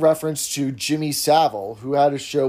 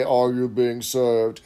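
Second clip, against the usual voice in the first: stiff shop assistant; 3 semitones lower, slower than usual. Retold or imitated. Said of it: "You don't stroll up and say".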